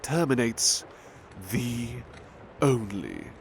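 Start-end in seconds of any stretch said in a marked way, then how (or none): none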